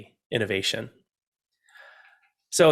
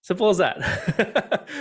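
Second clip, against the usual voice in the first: laughing; 9 semitones higher, much slower than usual. Real, not acted. Laughing punchline.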